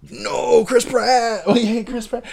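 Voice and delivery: high-pitched protesting players; whiny mock wail of protest; mock outraged crowd. Voices Koreans reacting to Chris Pratt.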